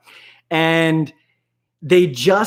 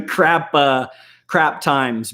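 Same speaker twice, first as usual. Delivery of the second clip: ordinary talk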